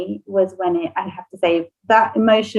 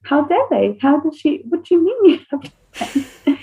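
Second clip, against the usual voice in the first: higher, hammed-up protective outrage; mock outrage; 7 semitones higher than usual. Mum reacting to her daughter having no friends with mock outrage. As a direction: play the offended mum, half joking. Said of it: "I was like".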